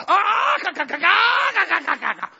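high, loud panicked clucking; loud mock chicken flapping off; frantic chicken squawk. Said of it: "that old chicken will go".

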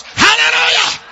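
high, loud shouted hallelujah; joyful hallelujah. Acted. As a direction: cry it out for joy. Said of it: "The dirt goes".

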